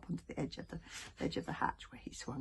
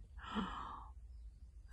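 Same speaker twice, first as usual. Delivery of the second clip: sharp gasp; 4 semitones higher, 9 dB quieter than usual. Acted. Sudden realisation.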